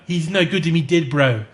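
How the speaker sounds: put-on Boba Fett in a Kiwi accent; New Zealand accent. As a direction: growl it like a Kiwi bounty hunter. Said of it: "With a New Zealand accent".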